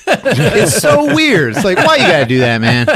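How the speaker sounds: high, loud, helpless laughter through words; real laughing disbelief; laughing while talking. Real, not acted. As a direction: crack up as you speak. Cracking up at the scene.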